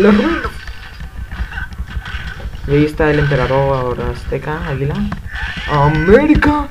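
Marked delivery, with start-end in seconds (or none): 5.7-6.7 mock cheer. Real, not acted. Cheering a Mexican team.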